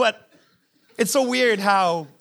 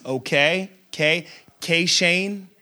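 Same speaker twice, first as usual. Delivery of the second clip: cold curt wife; 5 semitones lower, slower than usual. Retold or imitated. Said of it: "I get a chill when she says Shane".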